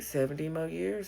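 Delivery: normal talking voice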